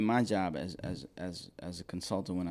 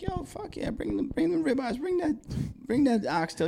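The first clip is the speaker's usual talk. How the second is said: much higher, thrilled shout of approval; pitched way up, all in and eager; hyped-up yes. Retold or imitated. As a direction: cheer like the best pitch just landed. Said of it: "I'm like".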